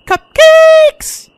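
very high, loud excited shout; piercingly high, gleeful cry; exuberant squeal. Acted. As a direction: belt it out at the top of a squeaky voice. Song's big excited final cry.

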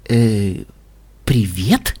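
low, uncertain hello; hesitant greeting. A narrator's reading. Listener caught out by Pinkie, hesitant. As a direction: answer haltingly, as a question.

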